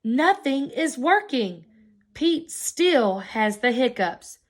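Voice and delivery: ordinary delivery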